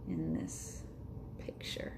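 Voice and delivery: soft whispered aside. Real, not acted. Reader sharing a quiet aside with the child, whispered. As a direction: breathe it like a secret.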